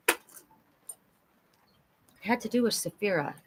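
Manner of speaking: soft, trailing thinking aloud; barely audible, talking to yourself; musing to oneself. Real, not acted. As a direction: quietly grope for a lost thought. Trying to recall a lost thought.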